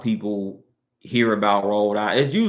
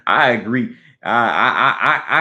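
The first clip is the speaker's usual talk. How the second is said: real laughter breaking into agreement; laughing too hard to get going; 5 dB louder, slower than usual. Real, not acted. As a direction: agree through a laugh, stammering on the start. Laughs at the snobby remark.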